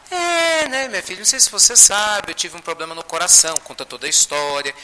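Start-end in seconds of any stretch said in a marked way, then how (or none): 0.1-3.5 put-on hesitant old friend explaining. Chico telling the spirit about his heart, hesitant explaining. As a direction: begin high on a long "É", then explain.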